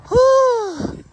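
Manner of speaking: high, loud cry of triumph at the top; real whoop of relief and joy; triumphant whoop. Real, not acted. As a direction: let out a joyous summit whoop. Reaching the pyramid top.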